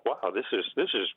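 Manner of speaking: breezy, carefree dismissal of the task; naive surprise. Retold or imitated. Graduate student recalling his naive thought, airily dismissive. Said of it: "I naively thought".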